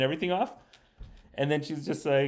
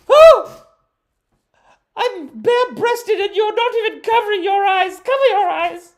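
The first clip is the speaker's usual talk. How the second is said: very high, loud shocked falsetto; mock shocked woman; 12 dB louder than usual. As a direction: squeal in hammed-up outrage.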